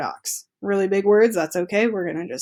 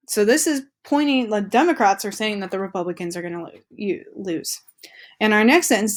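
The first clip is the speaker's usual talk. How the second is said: ordinary talk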